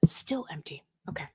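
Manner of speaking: tense whisper; Shatner whisper. Pretend. Host as Shatner whispering at the window. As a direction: hush it, peering at the empty wing. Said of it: "my best William Shatner".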